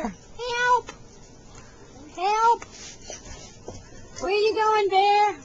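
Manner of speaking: high pretend cries for help; mock fright. Playing scared of the toddler bear, high pretend cries. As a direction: squeal for help at the little bear.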